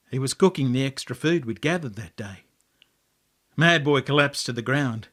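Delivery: ordinary delivery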